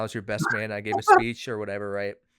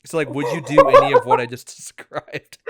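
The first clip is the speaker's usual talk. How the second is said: laughing; 10 dB louder than usual. Real, not acted. Cracking up at own setup.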